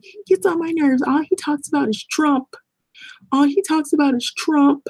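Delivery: high-pitched mocking complaint; put-on high whine of annoyance; irritated coworker. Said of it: "she told me how irritated she was with him".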